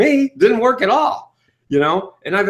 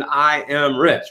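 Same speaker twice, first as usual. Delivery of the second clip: classic affirmation; slower than usual. Shown as a demonstration. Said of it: "say the classic affirmation".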